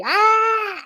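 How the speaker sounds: very high mock scream at moderate volume; little-girl scream. Storyteller imitating own bloody-murder scream, shrieky and mock-terrified. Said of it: "I'm screaming, like, for bloody murder, like a little girl".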